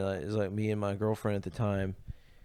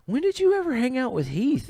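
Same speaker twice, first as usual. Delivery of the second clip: mock incredulous buddy; 13 semitones higher than usual. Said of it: "my buddy was like".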